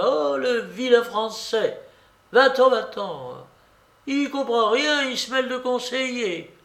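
put-on high, railing old-lady voice; outraged babouchka. A narrator's reading. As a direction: rail indignantly at the meddler. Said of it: "s'écria la babouchka". (vieille dame outrée)

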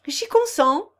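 high, loud, cheerful consent; enthusiastic gaiety. A narrator's reading. Old carpenter blessing the match, with enthusiastic gaiety. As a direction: give consent with joyful enthusiasm. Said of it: "avec un accent de gaieté enthousiaste".

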